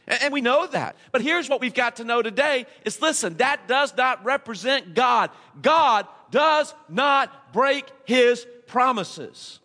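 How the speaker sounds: high, urgent sermon build; rising pulpit preaching; fervent preaching. Acted. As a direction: preach it out to the room with fire. Preacher presses congregation, rising fervour.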